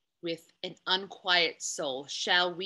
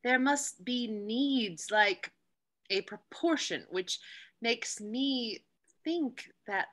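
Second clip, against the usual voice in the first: ordinary talk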